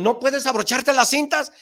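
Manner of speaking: high, loud nagging put-down; mocking shout. Imitating people saying you can't tie your shoes, high and loud. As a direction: bark the put-down like a scold. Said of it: "O te dijeron".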